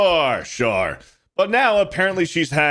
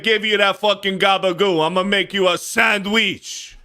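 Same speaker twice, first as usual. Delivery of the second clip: mock Italian-American deli guy; 7 semitones higher than usual. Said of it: "that Italian thing".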